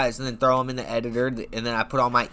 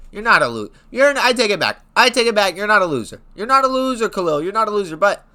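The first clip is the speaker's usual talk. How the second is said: high, loud hurried take-back; frantic backpedal; 7 semitones higher, 6 dB louder than usual. Acted.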